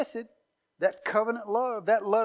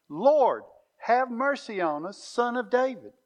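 loud, raised, pleading call for mercy; crying out; 5 semitones higher, 5 dB louder than usual. Acted. Blind men beg Jesus by the road, loud pleading cry. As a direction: cry out to him as he passes by. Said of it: "cried out".

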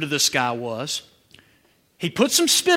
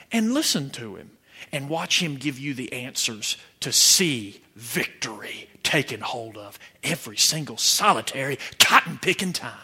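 ordinary delivery

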